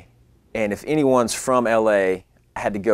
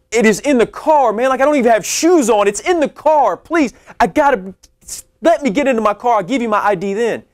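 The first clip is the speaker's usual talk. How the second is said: much higher and louder, near yelling in panic; frantic high pleading; 14 semitones higher, 6 dB louder than usual. Retold or imitated. Telling the salesman his ID is locked in the car, frantic and high. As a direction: squeal it out, cornered and desperate.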